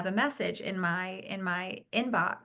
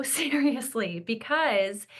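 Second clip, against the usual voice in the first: ordinary talk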